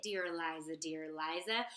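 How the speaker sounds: plain reading voice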